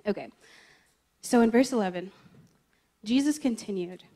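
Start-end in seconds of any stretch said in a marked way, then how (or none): none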